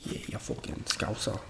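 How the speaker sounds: hushed mock British accent; soft, tossed-off British accent imitation. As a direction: mumble a bad British accent under your breath. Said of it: "Oh, yeah, British accents".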